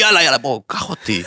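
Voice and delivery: low, coaxing persimmon talking. Pretend. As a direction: lure him in as the fruit. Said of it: "והוא אומר לי". (אפרסמון מפתה בקול נמוך)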